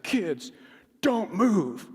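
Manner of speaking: put-on father ordering his kids to stay put, urgent; urgent command. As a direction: stop them in their tracks. Urgent order after seeing blood.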